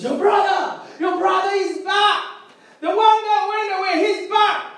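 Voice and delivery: high, shouted announcement; excited, high-pitched calling out; excited news. Acted. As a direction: cry out the news as the servant. Servant announces the return.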